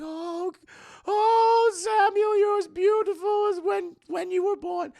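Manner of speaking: very high, loud falsetto cooing; overacted swooning over a baby; mock doting mother. Mother greets her returned baby, in a mock high coo.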